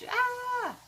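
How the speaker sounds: a tiny, very high mock scream of a falling figure. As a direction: squeak out a falling cry.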